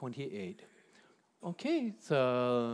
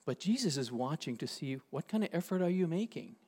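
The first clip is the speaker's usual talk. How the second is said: ordinary talk, nothing marked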